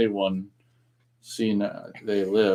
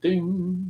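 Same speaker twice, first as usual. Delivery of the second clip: bell ding; 7 semitones higher than usual. Shown as a demonstration. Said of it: "the one I just did".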